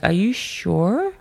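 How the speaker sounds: slow, rising, sceptical check; doubting question. Retold or imitated. Girl asks the woman wanting out, slow doubtful question. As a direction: stretch it out, pitch climbing in doubt.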